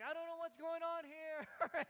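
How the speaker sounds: put-on high, slow little-kid voice, drawn out; dad imitating his naked son running around crying; confused toddler. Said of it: "just crying like".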